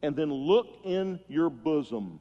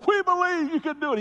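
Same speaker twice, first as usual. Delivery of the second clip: high, loud, rousing cheer of encouragement; cheering crowd; 6 dB louder than usual. Retold or imitated. Crowd hyping the walker to cross, high loud cheer. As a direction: shout it up like a crowd hyping him.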